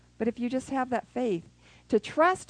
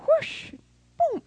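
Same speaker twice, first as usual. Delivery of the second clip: high, loud sound effects; whoosh and boom; 3 dB louder than usual. Acted. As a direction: make the tree fly and crash. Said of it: "Do you get a picture of that".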